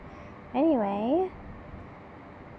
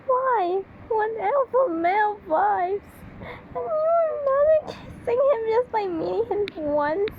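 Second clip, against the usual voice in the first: outraged disbelief; 10 semitones higher than usual. Real, not acted. Reader outraged at the heroine's crush. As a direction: shriek in disbelief.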